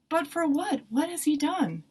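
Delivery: high-pitched, disbelieving questions; incredulous protest. A narrator's reading. Pilate objects to the crowd's verdict, incredulous.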